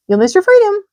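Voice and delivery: very high, loud honking goose cries; a goose barking orders to the pig; shrill-pitched urgent goose shouts; excited goose. A narrator's reading. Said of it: "honked the goose".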